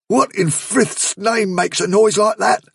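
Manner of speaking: high, slow, tense question; spooked and aghast, pitched well up; alarmed demand. A narrator's reading. Bigwig reacts to eerie wailing.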